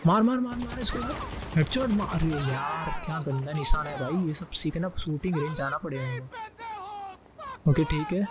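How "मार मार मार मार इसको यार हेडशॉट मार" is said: egging on a teammate mid-firefight; excited yelling. Real, not acted. Gamer urging teammate to shoot, excited high yelling.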